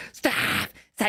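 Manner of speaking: mock frantic yell; imitated scream. Podcaster acts out the official breaking up a brawl, mock screaming. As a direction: imitate someone screaming at full tilt. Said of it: "screaming at the top of his fucking lungs".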